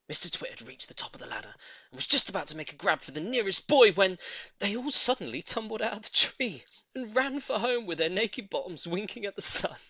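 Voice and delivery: usual speaking voice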